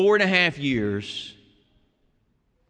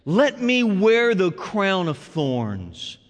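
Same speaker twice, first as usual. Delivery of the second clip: slow solemn cadence; much slower than usual. Real, not acted. Preaching Jesus' surrender.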